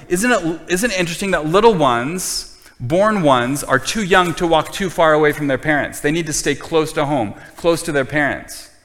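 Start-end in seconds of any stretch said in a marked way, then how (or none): none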